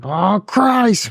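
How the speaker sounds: high exasperated groan of a phrase. Retold or imitated. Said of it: "I'm like".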